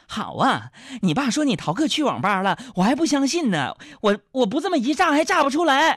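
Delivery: mock outrage climbing in pitch; acted mother catching out her son; scolding mother. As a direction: build to a high indignant finish. Mother rages at caught truant.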